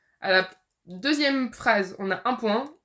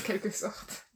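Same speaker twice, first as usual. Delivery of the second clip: soft puzzled echo; 2 semitones lower, 12 dB quieter, slower than usual. Real, not acted. Echoes the hint.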